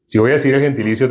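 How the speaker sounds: ordinary talk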